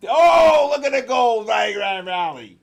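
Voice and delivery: high, very loud burst, falling away; real, full-volume excitement at a sudden jump; excited shout. Real, not acted. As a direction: shout the rally like a sportscaster. Excited reaction to gold rally.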